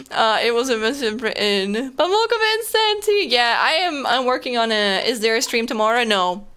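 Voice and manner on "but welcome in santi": cheery greeting. Real, not acted. Greeting a viewer in chat.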